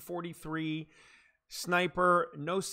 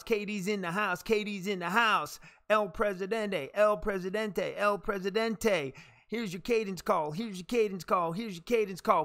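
raised, rhythmic triple-repeat chant; cadence call; 3 semitones higher than usual. Pretend. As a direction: chant each phrase three times like a drill call. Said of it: "it was a cadence".

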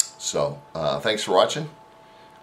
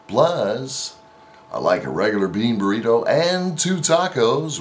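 big booming radio announcer voice, louder and higher; 4 semitones higher, 4 dB louder than usual. Pretend. Ordering burritos at the drive-through speaker in a radio announcer voice. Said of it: "I decide that I'm gonna do my radio voice".

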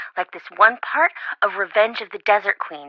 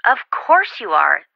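ordinary delivery

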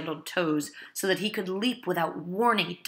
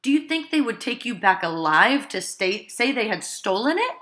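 loud, higher urgent plea; desperate pleading; 4 semitones higher, 8 dB louder than usual. Acted. Wife pleading with her husband not to give up, loud and urgent. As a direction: push him hard with the question.

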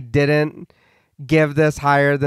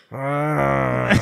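zombie groan; 4 semitones lower, 6 dB quieter than usual. Pretend. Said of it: "that's the best I got".

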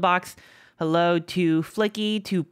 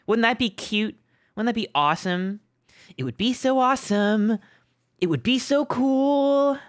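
mock glee; 6 semitones higher than usual. Streamer mocking chat with fake sweet delight. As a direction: gush over it with fake delight.

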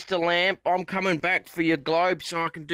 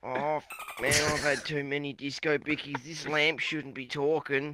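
a lightly voiced dazed drunk bloke; boozy bloke; 3 semitones lower, 8 dB quieter than usual. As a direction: mumble it like a wasted old mate. Drunk character voice.

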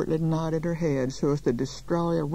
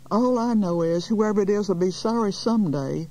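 higher, louder protest; raised, affronted voice; indignant cry; 6 semitones higher, 4 dB louder than usual. A narrator's reading. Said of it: "cried Miss Fletcher".

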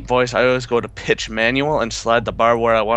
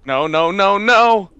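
repeated no climbing higher and louder; rising panic; 9 semitones higher, 6 dB louder, slower than usual. Real, not acted. Player cornered in a game, panic building.

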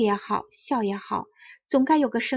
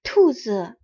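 louder startled cry; cry of surprise; 5 dB louder than usual. A narrator's reading. Three mothers finding rabbits in the field, startled louder cry. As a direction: blurt it out in shock. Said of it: "然后惊叫起来".

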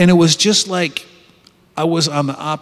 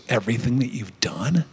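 whisper; 6 semitones lower, 8 dB quieter than usual. Retold or imitated. Inner voice forbidding confession.

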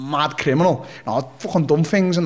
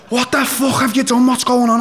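much higher, louder panicked yell; alarmed shout; 10 semitones higher, 5 dB louder than usual. Retold or imitated. Mum's boyfriend running out, shouting in alarm. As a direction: burst in demanding answers.

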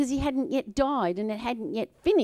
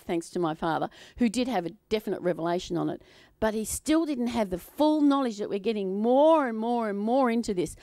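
ordinary talk

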